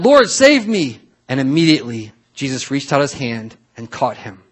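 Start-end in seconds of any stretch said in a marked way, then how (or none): 0.0-1.0 higher, louder cry for help. A narrator's reading. Sinking Peter cries out to Jesus for saving. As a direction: cry out as you read. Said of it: "he cried out".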